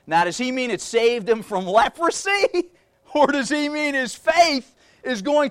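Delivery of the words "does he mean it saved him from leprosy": normal talking voice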